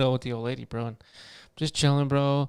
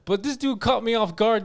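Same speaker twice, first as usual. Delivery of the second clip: ordinary talk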